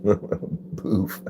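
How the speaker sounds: breathy chuckle through the word Boof, spoken softly; laughing. Real, not acted. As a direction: say Boof while laughing it out under your breath. Repeating the funny name, laughing.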